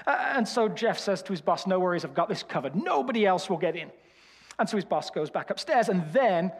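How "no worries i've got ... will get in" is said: lightly voiced Birmingham doorman; a hint of Birmingham, confident; Birmingham accent. Jeff reassuring his boss in a light Birmingham accent. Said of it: "a lot of development to get this accent".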